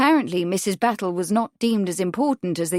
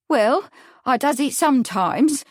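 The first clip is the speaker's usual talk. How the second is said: higher-voiced, mildly reluctant admission; 6 semitones higher, 4 dB louder than usual. A narrator's reading. Witness admitting eavesdropping to the lawyer, reluctant. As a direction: admit it reluctantly, with a rising end. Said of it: "Missus Battle replied reluctantly".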